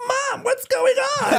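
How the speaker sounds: put-on very high, slow, crying child voice; a confused little kid wailing to his mom; small child. Four-year-old in a theater asking his mom, very high crying voice. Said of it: "a four-year-old's like".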